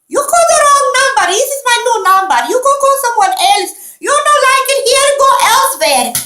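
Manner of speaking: shrieky-high, loud mock Indian accent; hammed-up Indian accent, way up high. Shown as a demonstration. Fending off wrong-number callers, in an exaggerated Indian accent. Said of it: "Let me try it again".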